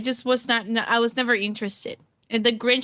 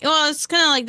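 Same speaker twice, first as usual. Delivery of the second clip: normal talking voice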